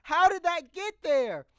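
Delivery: almost shrieking in disbelief; shocked disbelief. Retold or imitated. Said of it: "I was like".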